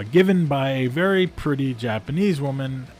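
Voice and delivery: ordinary talk, nothing marked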